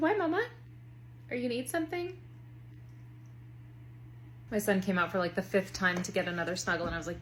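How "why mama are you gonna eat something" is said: put-on much higher, puzzled child voice; high kid asking mama about the spoon; little son voice. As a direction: ask it innocently like a small boy. Son misreads spoon threat.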